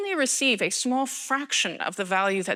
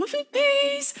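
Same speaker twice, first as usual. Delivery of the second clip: squeaky punchline; slower than usual. Real, not acted. Joking about her consultancy income.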